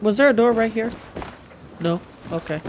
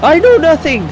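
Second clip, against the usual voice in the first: shouting; 8 semitones higher, 7 dB louder than usual. Pretend. Mock dramatic outburst while playing.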